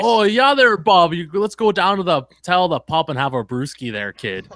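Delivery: put-on shitty Canadian accent; northern small-town accent. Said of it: "That's what everyone in my town talks like".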